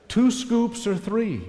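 high, slow mock host voice offering coffee; lightly voiced friend asking politely; friend's offer. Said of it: "and he says".

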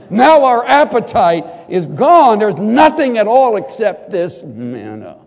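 gritted-teeth complaint. Acted. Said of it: "kind of with gritted teeth".

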